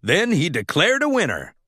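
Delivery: plain reading voice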